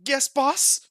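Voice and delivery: high, loud frightened reply; scared squeak. Acted. Shaking Roka answers his angry boss in a high squeak. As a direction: squeak it out, terrified. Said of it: "starts shaking".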